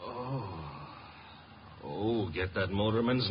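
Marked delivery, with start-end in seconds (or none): 0.0-0.4 dazed groan. Acted. Archie coming to after a blow.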